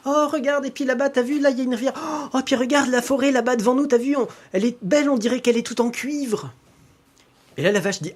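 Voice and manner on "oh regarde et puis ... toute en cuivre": put-on high, louder girlish voice marvelling; full of wonder. Girl on the cow marvelling at the landscape, high excited voice. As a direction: point at everything like a child seeing the world first time. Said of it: "tout l'émerveillait".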